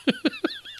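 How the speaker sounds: quick burst of high laughter; short high laugh. Real, not acted. Laughs after his sales pitch.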